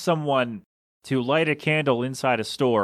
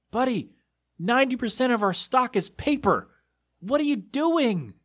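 exasperated disbelief; 9 semitones higher than usual. Real, not acted. Retelling the candle-lighting customer, incredulous high voice. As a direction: ask it like you can't believe what you saw.

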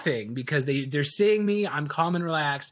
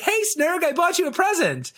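much higher, bright eager greeting; eager salesperson; 12 semitones higher than usual. Acted. Salesperson greeting his friend Snurg with a gift, much higher and eager. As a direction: chirp it like an overly keen salesman.

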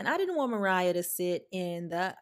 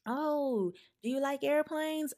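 lightly voiced chatty stranger, higher; a hint of a man sweetly chatting up a child; imitating a stranger; 7 semitones higher, slower than usual. Mimicking the man on the flight talking to Mariah, higher and sugary.

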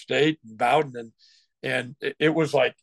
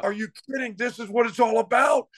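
high and thrilled, can't contain it; excited pep talk; 8 semitones higher than usual. Retold or imitated. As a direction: let the hype spill out. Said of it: "I was so excited".

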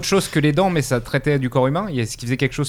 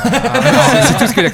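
laughing; 11 semitones higher, 7 dB louder, slower than usual. Real, not acted. Joking about sealed alcohol only.

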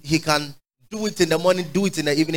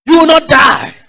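high and loud, hammered out; forceful assurance; 10 semitones higher, 7 dB louder than usual. Real, not acted. Preacher shouting assurance to the sick in the congregation. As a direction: shout the promise over them.

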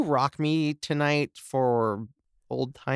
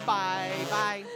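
laughing; 6 semitones higher, 5 dB quieter than usual. Real, not acted. Laughing still, almost crying.

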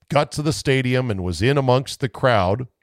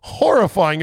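ordinary talk, nothing marked

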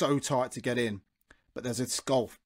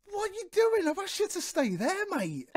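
high-pitched indignant telling-off; retold exasperation. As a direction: re-enact telling someone off, pitch jumping up. Retelling his row with banksman.

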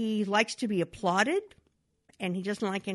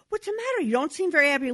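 higher, worried wife voice; concerned wife; 8 semitones higher, 6 dB louder, much faster than usual. Retold or imitated. Wife asking her upset husband what's wrong, higher. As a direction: ask him what's wrong, pitched up.

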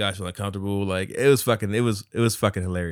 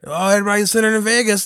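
Carlos impression; 9 semitones higher, 7 dB louder, faster than usual. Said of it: "this is how he sounded".

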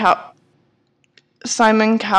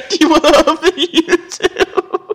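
laughing; 7 semitones higher, 4 dB louder than usual. Retold or imitated. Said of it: "would it said".

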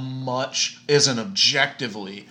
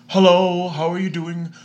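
put-on lifted, higher officer's accent voice; European customs agent impression; 5 semitones higher than usual. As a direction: play a polite border agent with a foreign lilt. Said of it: "that's a good impression".

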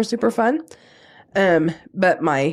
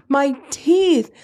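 higher, blubbering lament about his teeth; imitating husband crying; 7 semitones higher than usual. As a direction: whine it out like a grown man sobbing. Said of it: "And he's crying".

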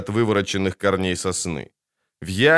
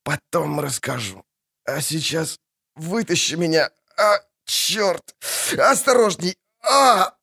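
wounded soldier; 6 semitones higher than usual. Acted. Wounded man being pulled out.

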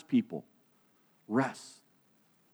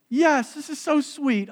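sweet delight; 9 semitones higher, 5 dB louder than usual. Retold or imitated. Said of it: "She's thinking".

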